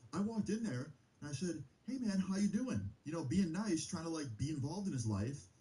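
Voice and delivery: high, sugary put-on friendly voice, mocking him; mock fake-nice boyfriend. Mocking the boyfriend's lie to mom in high fake-nice voice. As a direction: make his lie sound sickly sweet.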